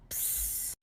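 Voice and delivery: soft voiceless psst; catcall hiss. Retold or imitated. Man calling to a woman on the road, hissing. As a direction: imitate a hissed street catcall.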